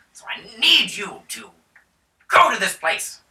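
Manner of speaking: high, loud noble voice; a lord recruiting heroes; hammed-up grand baron. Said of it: "the Baron pipes in".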